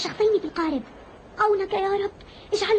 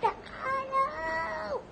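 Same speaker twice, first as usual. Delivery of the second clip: weak call; 7 semitones higher, 9 dB quieter, much slower than usual. Acted. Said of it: "عاد صوتي".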